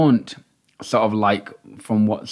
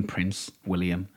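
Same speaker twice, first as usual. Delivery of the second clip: dry sarcastic aside; 6 semitones lower, 11 dB quieter, much slower than usual. Acted. Sarcastic jab at royal.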